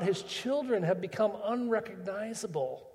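ordinary delivery

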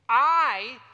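"I" said loud and held, like eye; stressed Hebrew I. Shown as a demonstration. Preacher stressing the key Hebrew word "I" to the crowd, loud. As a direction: punch the Hebrew word "I" out hard. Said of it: "contrasting the two words".

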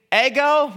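ego said high and loud as EH-go; Greek word ego. Shown as a demonstration. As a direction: call out ego, EH-go, clearly. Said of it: "The Greek is".

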